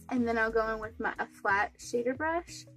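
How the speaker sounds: ordinary talk, nothing marked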